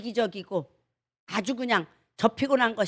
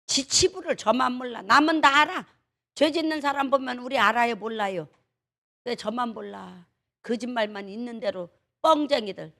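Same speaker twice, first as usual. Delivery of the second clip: ordinary talk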